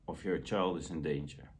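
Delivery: ordinary delivery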